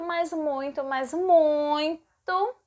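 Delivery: second muito stretched very slowly with a rise; drawn-out muito. Shown as a demonstration. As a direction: drag out muito to show how much. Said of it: "multipliquem aí o muito".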